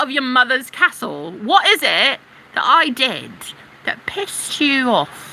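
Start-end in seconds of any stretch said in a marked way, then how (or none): none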